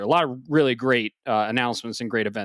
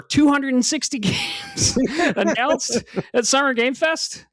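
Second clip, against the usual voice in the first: excited; 12 semitones higher, slower than usual. Real, not acted. Hyping 260 game announcements.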